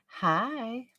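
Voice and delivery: high, soft mocking hi; lightly imitating a silly high voice; mocking voice impression. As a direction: mimic her voice to make fun of it. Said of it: "When I made fun of her voice".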